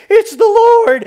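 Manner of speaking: high, loud cry of recognition; excited shout. Acted. Preacher as disciples spotting Jesus from the boat, excited shout. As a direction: burst out with the discovery.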